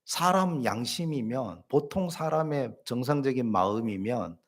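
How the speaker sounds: usual speaking voice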